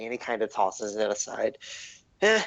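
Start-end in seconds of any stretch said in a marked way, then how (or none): 2.2-2.4 high, sly gnome voice. Acted. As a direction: coax the stranger, all sly and eager. Gnome sizes up the player.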